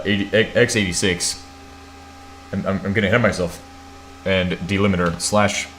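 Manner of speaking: usual speaking voice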